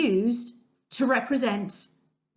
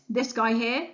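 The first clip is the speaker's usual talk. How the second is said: ordinary talk, nothing marked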